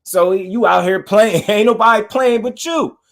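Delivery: high, worked-up ranting; excited. Real, not acted. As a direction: get fired up and insistent. Excited rant, high pitch.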